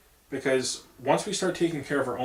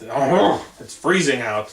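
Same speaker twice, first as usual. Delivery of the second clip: louder, mock-dramatic whine about the cold; mock whiny complaint; 8 dB louder, much slower than usual. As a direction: ham up a Californian shivering at fifty degrees. Mocking locals complaining about cold.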